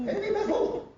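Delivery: puzzled royal, high and prim; Queen impression.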